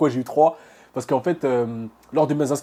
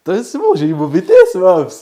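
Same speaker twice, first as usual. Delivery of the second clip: high, loud triumphant cry; excited shout; 5 semitones higher, 12 dB louder than usual. Real, not acted. Son telling his crying mother he passed, shouting with joy. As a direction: burst out with joy, almost yelling.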